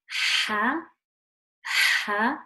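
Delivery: "ha" breathed hard from mid-throat, drawn out; middle-throat ha. Shown as a demonstration. A teacher demonstrates the mid-throat ha, slow. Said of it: "from the middle of my throat".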